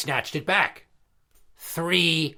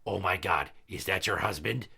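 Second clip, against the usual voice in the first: low, soft alarmed murmur; hushed panic; 8 semitones lower, 8 dB quieter than usual. Retold or imitated. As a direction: gasp it under your breath. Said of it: "I covered her mouth and I said".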